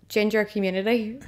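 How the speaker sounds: ordinary talk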